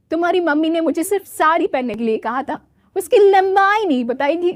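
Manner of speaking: quick, slightly high cheeky bride; young bride voice. Acted. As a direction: rattle it off like the naive bride. Soumya explains to husband. (तेज़, नटखट बहू की आवाज़)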